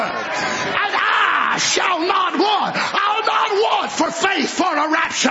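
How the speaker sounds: fiery climax of the Lord is my shepherd; preaching shout. Acted. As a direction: shout it to the back row, stretching the words.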